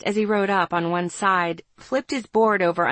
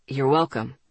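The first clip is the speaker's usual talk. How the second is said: lower-pitched male character voice; young man's voice; 6 semitones lower than usual. A narrator's reading. Boy explaining why he waited, in a low male voice. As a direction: drop the voice to play the boy.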